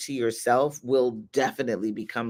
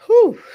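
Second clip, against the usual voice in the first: whew; 12 dB louder than usual. Real, not acted. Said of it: "I felt that in my soul".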